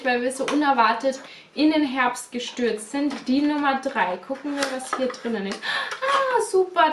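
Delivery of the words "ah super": a high, bright burst of delight; excited. Real, not acted. Unboxing number three, thrilled at the lip balm. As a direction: squeal it out with joy at the find.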